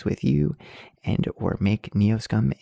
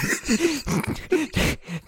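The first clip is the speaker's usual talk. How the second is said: giggle; 13 semitones higher than usual. Real, not acted. Laughs at his own bomb joke.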